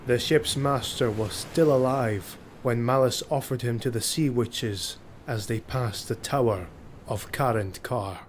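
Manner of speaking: ordinary delivery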